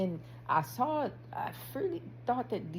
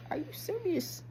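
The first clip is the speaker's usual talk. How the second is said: high, softer disbelieving question; pitched-up mock incredulity; incredulous self-check; 10 semitones higher, 4 dB quieter than usual. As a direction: ask yourself in mock disbelief.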